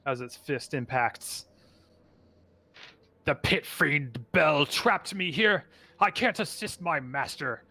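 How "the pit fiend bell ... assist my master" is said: higher, earnest voice of a trapped beast; hulking creature. Acted. Trapped creature bargains with adventurers, higher and pleading. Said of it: "hulking, almost gorilla-like figure".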